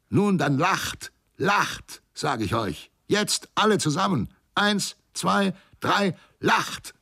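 hohes, steigendes wütendes Brüllen; wütendes Gebrüll. A narrator's reading. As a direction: die Mannschaft höhnisch anbrüllen, immer höher. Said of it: "sich wütend den Männern zuwandte und brüllte". (Steuermann brüllt die feigen Männer wütend an)

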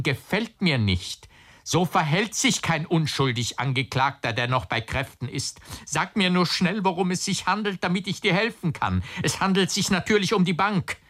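high-pitched, pressing insistence; scold, then urgently demand an answer; agitated reproach. A narrator's reading. Agitated uncle reproaches and presses nephew. (aufgeregt drängend, mit hoher Stimme)

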